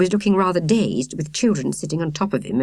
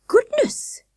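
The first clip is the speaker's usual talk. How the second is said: much higher startled cry; exclaimed. A narrator's reading. Miss Hilliard realizing it's an ibis, surprised. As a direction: gasp it out in surprise. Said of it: "Miss Hilliard exclaimed".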